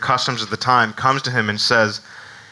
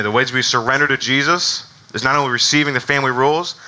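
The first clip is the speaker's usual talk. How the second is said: ordinary talk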